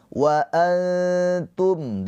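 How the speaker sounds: wa antum with the nun veiled and held; nun pada antum dibaca samar; ikhfa on antum. Shown as a demonstration. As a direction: hide the nun of antum, hold two beats. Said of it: "nun sukun bertemu dengan huruf ta".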